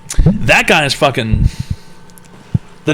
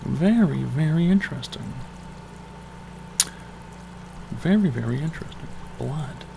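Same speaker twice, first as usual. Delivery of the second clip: hushed voice; 12 dB quieter than usual. Acted. Hushed far voice.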